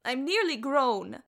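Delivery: put-on indignant young lad; protesting boy. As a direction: insist like an indignant child. Boy protests being left behind.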